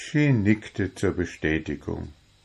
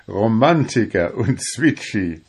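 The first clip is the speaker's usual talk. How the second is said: ordinary delivery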